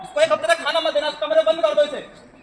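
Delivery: high, loud harsh commands; harsh order. Acted. Ordering son locked in.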